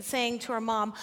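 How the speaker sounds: ordinary delivery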